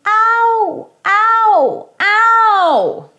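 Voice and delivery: high, loud mock cry of pain. Shown as a demonstration. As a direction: yelp like you stubbed your toe. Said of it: "Notice how that's a diphthong".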